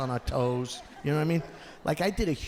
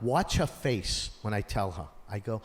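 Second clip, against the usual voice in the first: ordinary talk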